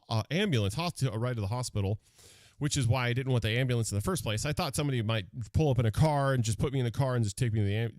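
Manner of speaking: usual speaking voice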